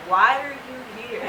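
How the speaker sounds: a deadpan nurse asking "Why are you here?" without interest; mild mock of a bored nurse; flat nurse. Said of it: "the antithesis of the nurse".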